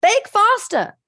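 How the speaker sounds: loud, high yell at the cake. Retold or imitated. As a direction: shout it at the cake to hurry. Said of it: "So you start yelling at it".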